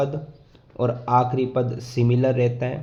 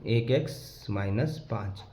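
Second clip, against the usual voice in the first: "एक x minus पाँच" repeated slowly and softer; 4 semitones lower, 8 dB quieter, slower than usual. Shown as a demonstration. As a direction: spell out the answer slowly for students. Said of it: "ये रहा आपका answer". (धीरे और नरम दोहराना)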